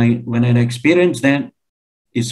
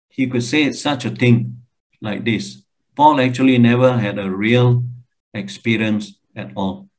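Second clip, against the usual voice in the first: normal talking voice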